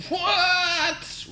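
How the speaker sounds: very high, loud drawn-out roar. A narrator's reading. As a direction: roar the word in fury. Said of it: "roared my dad".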